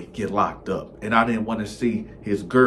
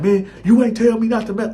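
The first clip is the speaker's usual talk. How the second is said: high, loud indignant whine; indignant protest; 12 semitones higher, 8 dB louder than usual. Retold or imitated. Friend protests he was never warned, high and loud. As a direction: protest like you were wronged.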